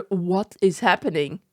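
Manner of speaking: normal talking voice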